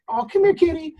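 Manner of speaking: high, sweet call to a stray cat; coaxing kitty voice. Retold or imitated. Coaxing a scared stray cat closer, high sweet call.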